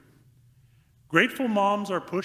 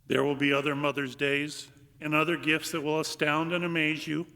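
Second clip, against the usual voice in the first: ordinary talk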